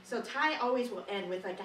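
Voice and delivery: ordinary talk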